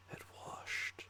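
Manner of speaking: ordinary talk, nothing marked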